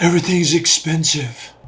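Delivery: put-on sulky whiny teen; bored teenager complaining; dumb teenager voice. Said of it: "dumb teenager voice".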